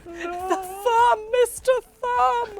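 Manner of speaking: high, loud hammed-up wail; mock crying. Guest acts out running away crying, mock terror. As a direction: sob the words out high, badly faked. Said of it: "I run away crying".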